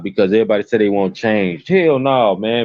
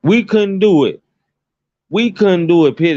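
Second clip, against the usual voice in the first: emphatic outburst; 8 semitones higher, 3 dB louder than usual. Real, not acted. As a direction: cry it out high and heavy. Passionate rant on community.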